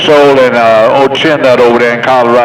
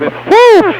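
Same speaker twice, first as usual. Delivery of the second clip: sudden high falsetto whoop. Real, not acted. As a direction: whoop it out high like cheering a buddy on. Cheering on the radio crew.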